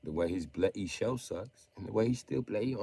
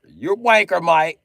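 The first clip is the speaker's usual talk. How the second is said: much higher, shouted insult; loud insult; 8 semitones higher, 14 dB louder than usual. Real, not acted. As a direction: bellow the insult. Insulting Kyle.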